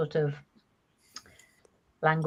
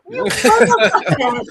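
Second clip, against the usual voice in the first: high, loud yell breaking into laughter; hammed-up indignant outburst with a laugh; mock outraged yell; 10 dB louder than usual. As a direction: shriek it in mock fury while laughing. Player acts Bunny's outraged first reaction.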